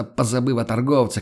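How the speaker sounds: ordinary delivery, nothing marked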